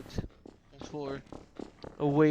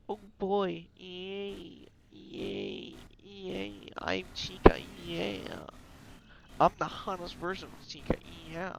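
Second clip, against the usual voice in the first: higher cutesy character voice; giddy Toy Chica; 5 semitones higher, slower than usual. Acted. Voices Toy Chica coming alive.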